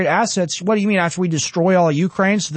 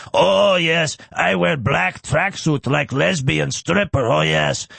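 lightly put-on Ukrainian accent, slow; a hint of a mocking Ukrainian accent; Zelensky impression; 3 semitones lower, slower than usual. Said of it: "my Zelensky impression".

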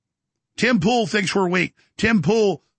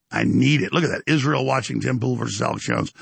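ordinary delivery

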